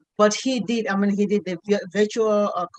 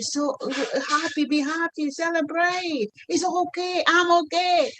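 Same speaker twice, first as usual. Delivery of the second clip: reassuring son; 7 semitones higher than usual. Retold or imitated. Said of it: "he says".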